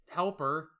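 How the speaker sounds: usual speaking voice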